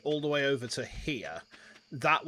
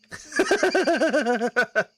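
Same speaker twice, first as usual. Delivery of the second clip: loud high laugh; burst of laughter; 10 semitones higher, 7 dB louder than usual. Real, not acted. Laughs at own joke.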